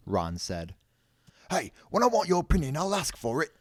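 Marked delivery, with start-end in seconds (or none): 1.5-3.5 high, loud angry bellow; bellowed rebuke. A narrator's reading. Dean snapping at Ron's suggestion, bellowing. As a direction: cut him down at raised volume. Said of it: "bellowed Dean".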